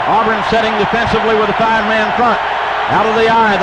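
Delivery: usual speaking voice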